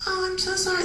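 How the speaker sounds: high, soft sorry voice; mock a flustered apology up high; lightly mocking high, hushed coworker; apologetic lady. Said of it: "And then she went".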